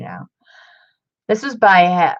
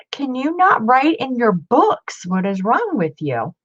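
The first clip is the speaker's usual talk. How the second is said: exasperated scolding; 6 semitones higher than usual. Real, not acted. Scolding old owner for writing.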